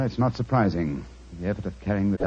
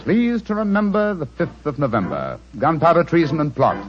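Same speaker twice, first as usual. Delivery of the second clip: reciting rhyme; 5 semitones higher, 5 dB louder than usual. Acted. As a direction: declaim the old rhyme, louder. Recites the Guy Fawkes rhyme.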